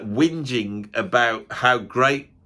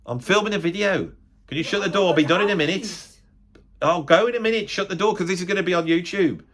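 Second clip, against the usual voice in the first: higher-pitched, insistent shooing; exasperated calling out; 5 semitones higher than usual. Real, not acted. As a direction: call to someone off-mic, real irritation at being interrupted. Calls to someone interrupting the filming.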